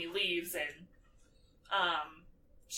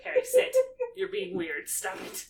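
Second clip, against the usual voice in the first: higher, sharp hushed scold; imitating Ginny; 13 semitones higher, 4 dB louder than usual. Ginny telling Harry to sit down, higher and scolding. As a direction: snap at him under your breath, much higher.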